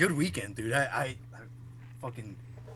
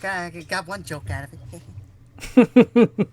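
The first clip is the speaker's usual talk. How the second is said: dad's New Yorker voice; 5 semitones higher, 10 dB louder than usual. Retold or imitated. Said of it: "He's like".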